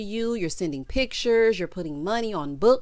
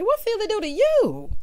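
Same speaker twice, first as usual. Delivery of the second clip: incredulous sass; 12 semitones higher than usual. Retold or imitated. Sassy retort voiced in recap.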